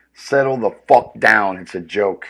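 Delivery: ordinary talk, nothing marked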